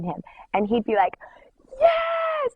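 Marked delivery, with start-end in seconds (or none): 1.8-2.5 very high, drawn-out squeal of delight; acted-out thrilled "yes"; excited cheer. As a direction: cheer as if overjoyed, voice shooting up. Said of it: "he'd be, like, so excited".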